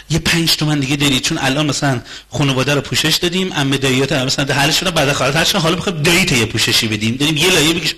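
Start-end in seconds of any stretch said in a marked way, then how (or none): none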